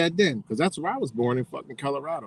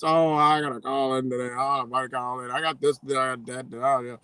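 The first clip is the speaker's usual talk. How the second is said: whiny, softer mock excuse voice; mocking lazy worker; 4 dB quieter than usual. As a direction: imitate a lazy guy calling in sick, dropping softer. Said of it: "Stupid ass Americans".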